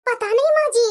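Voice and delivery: ordinary talk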